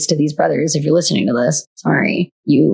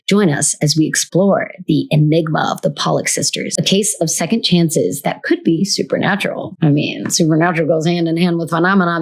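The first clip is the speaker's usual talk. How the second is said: ordinary delivery, nothing marked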